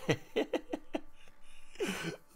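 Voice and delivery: high breathy laugh. Real, not acted. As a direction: burst out laughing. Amused at a chat joke.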